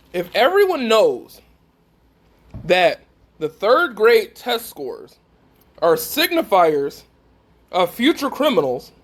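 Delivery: slow it down and let each phrase land; deliberately slow. Real, not acted. Preacher calming down to repeat the saying for the congregation, deliberately slow. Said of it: "I'm gonna try to say this a little bit slow".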